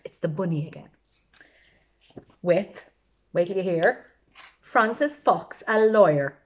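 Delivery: ordinary delivery, nothing marked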